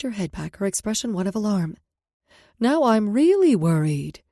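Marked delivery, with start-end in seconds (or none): 2.6-4.2 playful mock worry, slow and loud; teasing. Mom teasing her worried daughter, mock alarm. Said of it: "She said it in a teasing tone".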